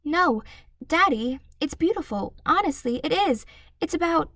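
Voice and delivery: put-on a girl begging her father to listen; high earnest girl's plea; pleading girl. A narrator's reading. Matilda voiced by narrator.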